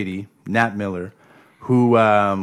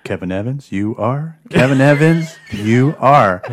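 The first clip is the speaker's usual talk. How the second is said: store PA announcement; 3 semitones higher, 6 dB louder than usual. Retold or imitated. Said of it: "grabbed the store microphone".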